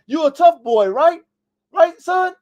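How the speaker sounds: put-on rally the kid like a proud father; loud, rising hype for a son; pumping up his son.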